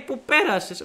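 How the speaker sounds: high-pitched mimicking voice; imitated headmaster. Headmaster tells student his exam news, high impression. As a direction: announce it in a comic high voice. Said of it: "Μου λέει".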